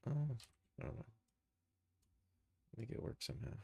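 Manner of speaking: ordinary talk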